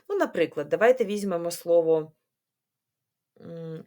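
ordinary talk

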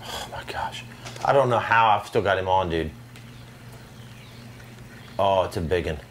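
ordinary delivery

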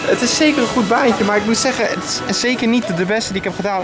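ordinary delivery

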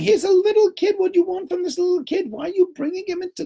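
very high-pitched pleading; high, incredulous mock objection; mock protest. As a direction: plead shrilly on the child's behalf. Acts out protest on child's behalf.

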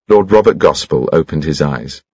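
usual speaking voice

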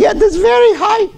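put-on high-pitched Russian-accented old teacher; high Russian-accented voice of the old Hebrew teacher; old-country Russian accent. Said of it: "very high, little thick accent like this".

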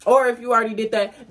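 ordinary delivery

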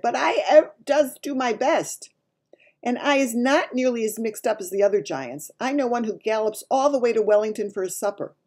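a hint of a big giant expounding; lightly voiced lecturing giant. A narrator's reading. Giant explaining human flavours to Sophie, lightly emphatic.

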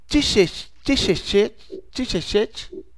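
"Tichechete" built up one syllable at a time; halting reading. Acted. New reader decoding a strange name, stumbling syllable by syllable. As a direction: stumble into "Tichechete", adding a piece each try. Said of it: "seu estilo de leitura".

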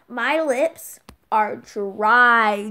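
very slow, loud, drawn-out words; overdone emphatic complaint; dramatic reveal. Acted. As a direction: stretch DRY out long and loud. Dramatic reveal about dry lips.

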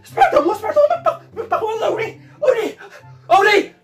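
high-pitched panicked cries; hysterical fear. Acted. Woman insisting to Obada that something moved, hysterical fear. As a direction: shriek in mounting terror. Panics over voice and moving object.

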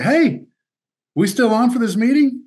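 a cheery, oblivious greeting up high; breezy coach. Retold or imitated. Coach greeting the family in the parking lot, cheery and high.

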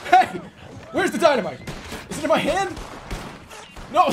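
very high, loud panicked yelling; frantic high-pitched gamer panic; frantic shouting. Real, not acted. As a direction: scream urgent orders in mid-fight. Frantic moment in the game.

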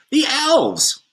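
put-on high, excited child's cry; excited shout. A narrator's reading. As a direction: call out in wonder. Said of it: "shouted one of the children".

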